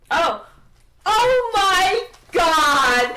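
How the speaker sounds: booming, high, drawn-out exclamation; overjoyed recognition. Acted. Merlin spotting his old companions, hammed-up overjoyed shout. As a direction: stretch every word in loud amazement.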